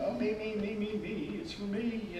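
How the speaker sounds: soft, whiny me-me-me; selfish whine. Acted. Selfish person wanting everything, in a soft mock whine. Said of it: "A selfish attitude".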